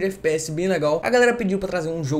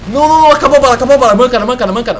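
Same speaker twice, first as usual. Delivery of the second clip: panicked yelling; 6 semitones higher, 12 dB louder, much faster than usual. Real, not acted. Out of ammo in a fight.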